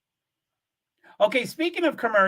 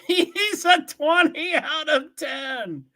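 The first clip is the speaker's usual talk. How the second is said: swooning; 8 semitones higher, much slower than usual. Real, not acted. Gushing over Jason Momoa.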